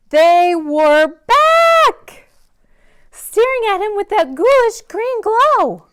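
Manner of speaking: high, loud, slow horrified build; dramatic scary reveal. A narrator's reading. Narrator reveals the underwear back in the drawer, horrified. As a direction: draw out the horror of the find.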